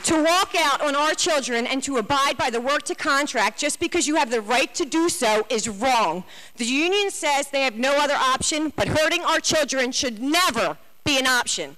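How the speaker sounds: impassioned. Real, not acted. Pushes on over the chair's interruption.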